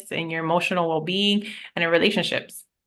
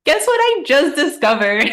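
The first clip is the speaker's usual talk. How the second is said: much higher and louder, an eager announcement; excited voice note; 8 semitones higher, 7 dB louder than usual. Retold or imitated. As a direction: burst out with the big news. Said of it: "sending you a voice note".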